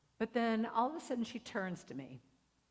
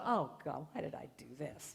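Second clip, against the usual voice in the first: regretful inner groan; 6 semitones lower than usual. Retold or imitated. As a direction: retell a private moment of dread. Own regretful thought while waiting.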